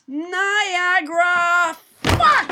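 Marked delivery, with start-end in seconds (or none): 2.0-2.5 sudden high yelled curse; shouted curse. Pretend. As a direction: yell it as you fall. Pretend fall from tightrope.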